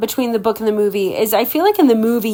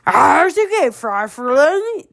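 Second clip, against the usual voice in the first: hissing ogre; 5 semitones higher, much slower than usual. Acted. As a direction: tease him like a sly ogre. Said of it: "the ogre hissed".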